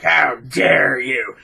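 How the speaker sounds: slow, drawn-out indignation; exaggerated offense, stretching every word; hammed-up affronted reply; mock outrage. Acts offended friend over car remark.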